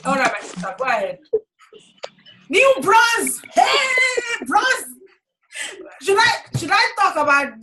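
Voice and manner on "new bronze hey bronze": high shouted celebration; excited cheer. Real, not acted. Leader celebrating Bridget's bronze rank, high excited shout. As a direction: cheer her new rank out loud.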